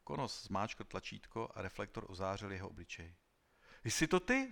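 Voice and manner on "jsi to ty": high, loud, startled question; astonished cry. A narrator's reading. Klorindo recognising Konos in the old film, crying out. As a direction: exclaim in sudden recognition. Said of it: "Zvolal Klorindo".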